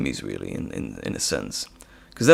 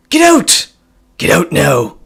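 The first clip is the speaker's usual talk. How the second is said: loud, high theatrical outburst; explosive mad-scientist order; villain's shout; 5 semitones higher, 10 dB louder, slower than usual. Acted. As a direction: drive the intruder out at full voice. Acted villain throwing out intruder.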